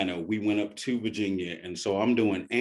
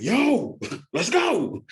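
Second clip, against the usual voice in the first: high, loud thrilled outburst with a laugh; excited yo; 14 semitones higher, 7 dB louder, much slower than usual. Retold or imitated. Retelling his excited yes to the director, high and loud. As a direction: yell it like you just got your dream role. Excited reaction to role offer.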